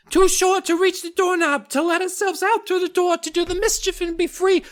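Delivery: very high mocking gnome whine; mock squeaky gnome. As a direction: parody the gnome's plea.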